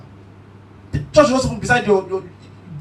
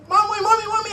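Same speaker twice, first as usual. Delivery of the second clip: mock scared cry; 8 semitones higher than usual. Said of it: "I was shouting".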